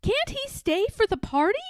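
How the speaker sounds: put-on high boy voice, hopeful asking; a kid begging for the monkey to stay; pleading little boy. Boy asking the nurse to let George stay, high and hopeful. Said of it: "a boy asked the nurse".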